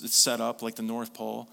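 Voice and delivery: usual speaking voice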